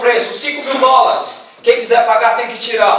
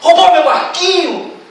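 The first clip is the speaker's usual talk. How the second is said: higher, slow accusing cry; accusing shout; 4 semitones higher, slower than usual. Acted. Young man accusing the shop vendor of theft, slow and outraged. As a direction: call him a thief, drawn out. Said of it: "olha pro vendedor e diz".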